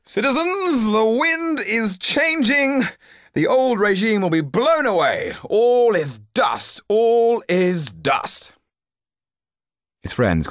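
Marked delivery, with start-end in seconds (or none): 0.0-8.5 put-on high, loud, slow drunken proclamation; drunken shouting. A narrator's reading. Drunk young man shouting at fellow drinkers, loud and slow. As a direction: bellow it to the whole café. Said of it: "kept standing up and shouting out".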